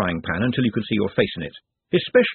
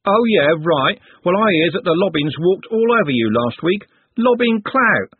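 cocky retort; 7 semitones higher, 5 dB louder than usual. Acted. Trev retorting to Juliet.

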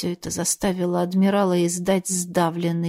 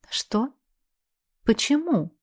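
mock-innocent confused question; feigned puzzlement; 5 semitones higher than usual. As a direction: play dumb, rising innocently. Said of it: "с наигранным недоумением".

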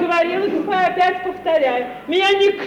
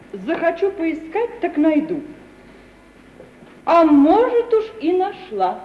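normal talking voice

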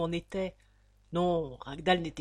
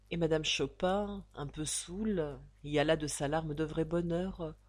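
ordinary delivery, nothing marked

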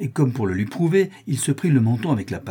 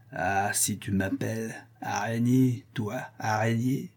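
put-on menacing drunk; 2 semitones lower, 6 dB quieter than usual. A narrator's reading. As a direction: drag it out quietly, like a menacing drunk. Drunken villain answers the child.

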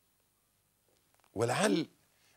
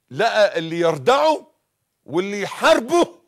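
louder, higher, slow preaching; rising sermon cry; 9 semitones higher, 11 dB louder, slower than usual. Acted. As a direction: build the call to resist. Preaching build. (وعظ متصاعد بصوت عال)

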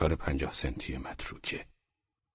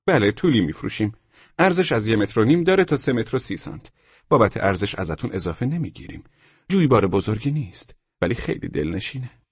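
higher, louder shop seller; emphatic, pitched-up salesman; salesman character; 5 semitones higher, 5 dB louder than usual. Acted. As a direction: rattle off the sales terms in a raised voice. Seller describes the stream.

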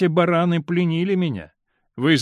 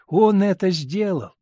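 put-on slow, childlike exclamation; a small child proudly declaring; little girl voice; 5 semitones higher, slower than usual. A narrator's reading. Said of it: "как она картавит".

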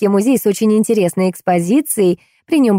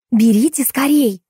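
higher-pitched, fussing urgency; flustered urging; 5 semitones higher than usual. A narrator's reading. Guide urges the visitor to take the note, flustered. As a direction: urge them on in a fluster. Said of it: "засуетилась моя спутница".

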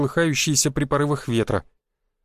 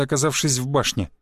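ordinary delivery

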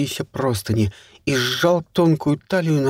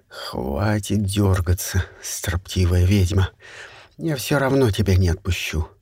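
low rough dominant man; 6 semitones lower than usual. Acted. Макс грубо приказывает Кай. (низкий грубый властный мужской голос)

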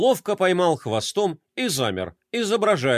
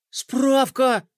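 much higher, gasped exclamation; startled gasp; 9 semitones higher than usual. Acted. Roma remembers the pool certificate, startled gasp. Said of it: "ахнул Рома".

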